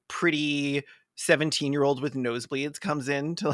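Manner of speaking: ordinary talk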